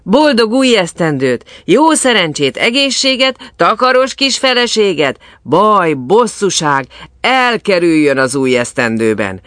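high, loud cheer of New Year wishes; jubilant, ringing toast; festive well-wishing. A narrator's reading. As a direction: call out good wishes to the crowd. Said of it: "kívántak egymásnak szerencsét az emberek".

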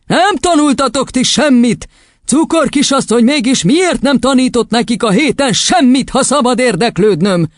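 high, gloating reproach; triumphant scolding. A narrator's reading. Headmistress blaming teacher for the week's lessons, triumphant. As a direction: rub it in with sarcasm. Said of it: "kiáltott diadalittasan".

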